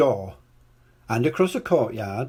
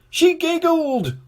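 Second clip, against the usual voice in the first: high, slow, weepy king voice; tearful king; 4 dB louder, slower than usual. A narrator's reading. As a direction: drag it out through tears. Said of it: "went on the king".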